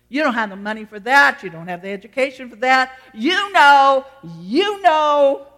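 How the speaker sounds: jeering accusation, building to a loud high cry; accusing taunt. Acted. Acting out Satan's accusing taunts.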